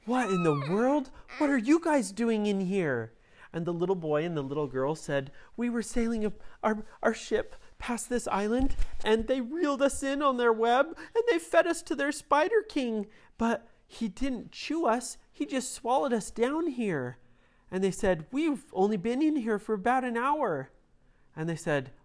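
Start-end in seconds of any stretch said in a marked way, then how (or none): 0.0-3.1 surprised heroes. A narrator's reading. Heroes question trapped kids. 5.5-17.2 put-on very high, small child voice; high little kids. A narrator's reading. Trapped children explaining to rescuers, high child voice. 18.3-20.7 put-on very high, small child voice; high little kids. A narrator's reading. Trapped children explaining to rescuers, high child voice.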